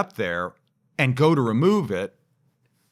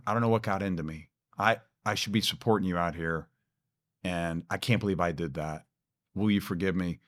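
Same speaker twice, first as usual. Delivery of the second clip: tearful apology; 5 semitones lower, 7 dB quieter, faster than usual. Retold or imitated. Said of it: "he's crying".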